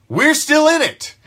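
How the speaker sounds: fired-up fan insisting on the playoff chase; rallying cry. Real, not acted. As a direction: lift it up as a call to hope. Repeated rallying line.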